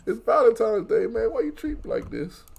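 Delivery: high, loud hurt whine; crying complaint. Acted. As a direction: wail it like someone badly mistreated. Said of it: "are you just crying".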